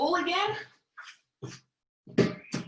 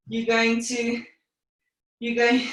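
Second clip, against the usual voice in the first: usual speaking voice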